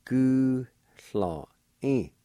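"Gulhöding" drawn out very slowly; slow repeat. Shown as a demonstration. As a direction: stretch "Gulhöding" out for learners. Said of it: "Pronounced like this".

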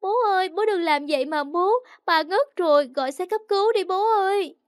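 put-on high-pitched child begging; panicky, wailing high plea; frightened pleading. A narrator's reading. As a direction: plead desperately with dad. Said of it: "vừa sợ vừa van xin bố".

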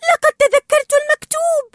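very high, loud squeal of joy; amazed excitement. A narrator's reading. Dory remembers for once, thrilled and disbelieving. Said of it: "ثم شهقت غير مصدقة".

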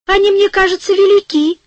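a small fairy complaining her shoes are big. A narrator's reading. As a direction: play the tiny fairy, light and high. Fairy voiced in story.

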